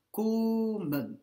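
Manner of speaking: komen with the O held long and high. Shown as a demonstration. Teacher repeating the example for students, komen with a long O. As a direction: draw out the first vowel of komen. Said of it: "le O est long".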